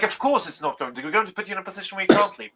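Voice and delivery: ordinary talk, nothing marked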